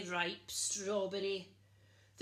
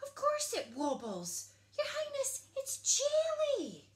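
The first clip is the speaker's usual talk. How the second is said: cook's voice jumping much higher, louder; flustered cook soothing the princess; 5 dB louder than usual. A narrator's reading. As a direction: squeak out a nervous reassurance. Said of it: "flustered the cook".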